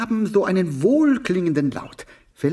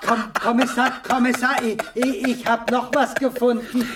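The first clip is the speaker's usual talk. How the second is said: excited laughing stammer; 8 semitones higher than usual. Acted. Aufgeregter Fund, lachend.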